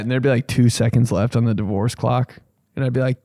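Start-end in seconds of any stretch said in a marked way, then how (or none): none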